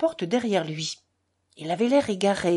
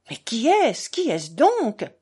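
exasperated, insistent questioning; 3 semitones higher, 5 dB louder, slower than usual. A narrator's reading. Doctor asks who this boy is, exasperated. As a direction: demand to know, losing patience. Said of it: "fit le docteur exaspéré".

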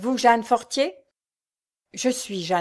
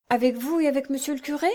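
put-on higher, quicker child voice; child asking; 5 semitones higher, faster than usual. A narrator's reading. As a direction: ask eagerly as the boy. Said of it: "fit Georges". (enfant qui demande vite)

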